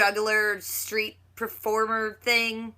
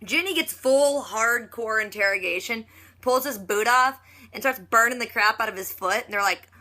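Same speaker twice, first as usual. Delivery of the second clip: ordinary talk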